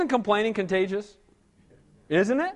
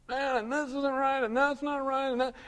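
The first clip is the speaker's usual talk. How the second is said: a softer, higher grumbling whine; mock complaining; 4 semitones higher, 8 dB quieter than usual. Churchgoer grumbles to the pastor, soft mock whine. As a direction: grumble it like a churchgoer who hates everything. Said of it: "complain about everything".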